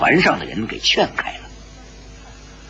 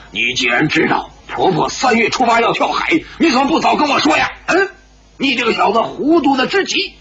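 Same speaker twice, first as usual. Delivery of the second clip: blaming, scolding; 8 semitones higher, faster than usual. A narrator's reading. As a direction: voice climbing high into furious threats. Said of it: "白自在却怪起了石破天". (责怪痛骂，声音越来越高)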